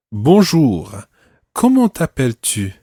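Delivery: model conversation. Shown as a demonstration. Teacher greets student in practice conversation, clearly projected role-play. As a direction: open a demonstration dialogue with emphatic pitch. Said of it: "I'm going to have a conversation".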